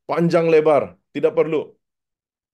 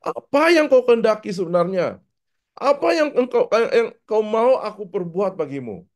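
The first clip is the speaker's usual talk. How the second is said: higher, mildly irritated question; rising impatience; 9 semitones higher than usual. Retold or imitated. Jesus losing patience with the vague beggar, mildly annoyed. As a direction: press him, intonation turning annoyed. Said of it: "mungkin dia sudah mulai marah".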